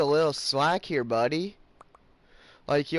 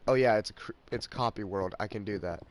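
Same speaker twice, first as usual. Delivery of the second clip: ordinary talk, nothing marked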